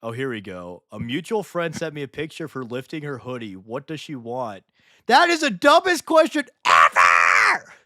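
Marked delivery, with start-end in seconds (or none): 5.0-7.7 high, slow full-volume outburst; exasperated yell, every word drawn out; outraged shouting. Real, not acted. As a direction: bellow it in disbelief. Outraged at the chat question.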